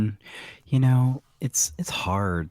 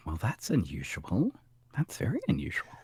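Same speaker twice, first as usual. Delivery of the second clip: whispered, conspiratorial; 8 dB quieter than usual. Acted. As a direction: hush it like a secret. Mock suspicion at plot twist.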